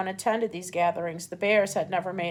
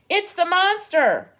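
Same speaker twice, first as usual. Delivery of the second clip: high, loud screech; 9 semitones higher, 6 dB louder than usual. A narrator's reading. Owl screeching at the robot in alarm. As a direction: shriek it in alarm.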